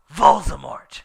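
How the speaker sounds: loud, ominous reveal; dramatic announcement. A narrator's reading. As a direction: announce the villain with dread.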